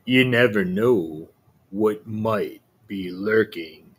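low, slow, scheming wolf; a cunning wolf savouring his plan, slowly; sly wolf voice. Acted.

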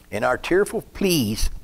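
ordinary delivery

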